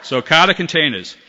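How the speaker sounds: laughing at himself through Kata containers. Real, not acted. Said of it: "why do I do this".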